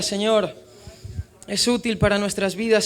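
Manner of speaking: ordinary delivery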